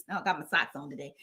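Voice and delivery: ordinary talk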